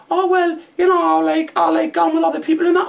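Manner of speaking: very high mock girl voice; imitating Scruffy Lisa. Taxi driver mimicking his passenger in a very high mock voice. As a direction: squeak it out as the lass. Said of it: "She went".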